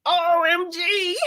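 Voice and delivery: high, slow squeal of delight. Real, not acted. Embroiderer squealing at her cute turkey design. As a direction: squeal the letters out.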